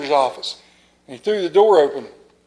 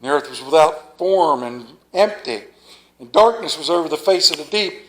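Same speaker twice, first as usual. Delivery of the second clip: scripture reading; 3 semitones lower than usual. Acted. Preacher reading Genesis to the congregation, solemn. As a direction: proclaim the opening of Genesis.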